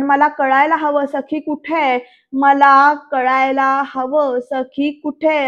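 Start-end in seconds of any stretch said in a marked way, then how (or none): 2.3-5.5 slow, louder mock-angry demand. Sarkar demands to know where Sakhi is, angry. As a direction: stretch each word out in acted anger. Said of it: "असं रागात बोलतात".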